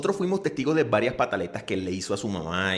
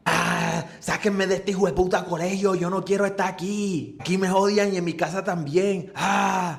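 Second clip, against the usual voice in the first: high whining brat voice; hammed-up tantrum on the school floor; mock tantrum wail; 7 semitones higher than usual. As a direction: wail it like a kid throwing a fit. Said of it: "empieza a hacer su espectáculo".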